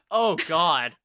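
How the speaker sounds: ordinary talk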